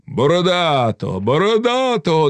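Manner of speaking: high rallying call; crowd calling out. A narrator's reading. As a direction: chant the candidate's name, rising. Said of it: "гукали деякі".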